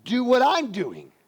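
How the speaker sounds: slow, each word pressed out; slow demanding Martha. Acted. Martha to Jesus, slow demanding voice. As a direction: drag out the demand. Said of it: "She says".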